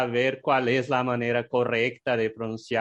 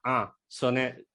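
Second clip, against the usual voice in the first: ordinary talk, nothing marked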